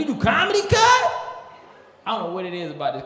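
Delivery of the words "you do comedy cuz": high, loud astonished Crip; amazed shout. Retold or imitated. Crip amazed the comic does stand-up, shouting high. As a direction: shout in disbelief.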